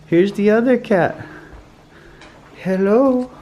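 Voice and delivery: high, loud delighted coo; cooing pet talk. Real, not acted. Vlogger finding the other cat, high cooing pet talk. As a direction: lift it right up to greet the pet. Finds and greets the second cat.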